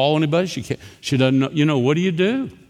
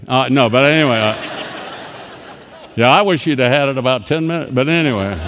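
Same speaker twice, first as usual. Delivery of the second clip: joking aside; 5 dB louder, faster than usual. Real, not acted. Jokes with owner of phone.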